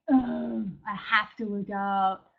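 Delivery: mock reluctant, slow "have to"; reluctant sigh. Reluctant self-talk about a workout, burdened sigh. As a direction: sound burdened by the chore. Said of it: "do you say".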